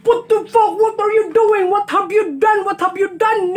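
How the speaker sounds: high, exasperated British chef rant; Gordon Ramsay impression. Vlogger mimicking a chef scolding, high mock rage. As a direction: play the furious celebrity chef. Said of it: "Try na to, Gordon Ramsay".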